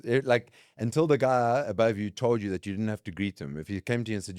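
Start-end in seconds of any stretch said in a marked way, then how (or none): none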